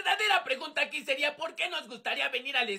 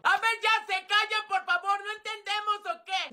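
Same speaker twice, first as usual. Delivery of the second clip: high-pitched exasperated teacher; teacher scolding; 7 semitones higher than usual. Acted. Teacher quieting unruly pupils, scolding. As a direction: call for order in a high voice.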